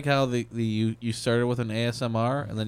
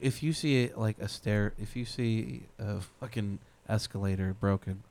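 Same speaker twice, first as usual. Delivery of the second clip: soft mock-profound; 8 dB quieter than usual. Mock deep musing, soft.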